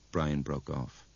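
ordinary delivery

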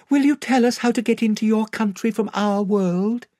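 put-on high-pitched child pleading to be told; Lucy, earnest child. Lucy asking the lion the way to his country, high and earnest. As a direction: ask eagerly in a high young voice.

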